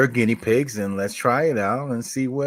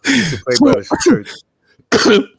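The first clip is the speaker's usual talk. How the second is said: higher, louder mock priest; mock cursing priest; 10 semitones higher, 7 dB louder, slower than usual. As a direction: bark it like an angry priest. Still in put-on voice.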